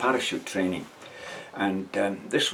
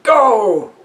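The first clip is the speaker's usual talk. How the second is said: sudden high yell, much louder; shouted go; 13 semitones higher, 13 dB louder than usual. Retold or imitated. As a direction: bellow it like a sergeant. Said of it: "The sergeant shouted".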